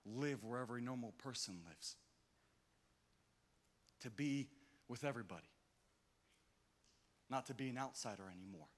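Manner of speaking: hushed hopeful preaching; soft sermon voice. Acted. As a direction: settle it tenderly.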